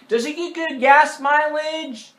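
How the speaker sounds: high, loud, slow caricature; pestering kid voice. Retold or imitated. Neighbor kid bothering the driver, annoying kid voice. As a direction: ask it like an irritating child.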